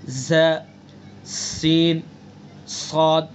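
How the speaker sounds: ze, seen, saad with a sharp whistle; whistled sibilants. Shown as a demonstration. Teacher demonstrating the three whistle letters, whistled ze, seen, saad. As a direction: hold the hiss on ze, seen and saad. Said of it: "سیٹی کی طرح تیز آواز".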